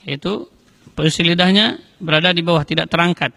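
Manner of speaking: usual speaking voice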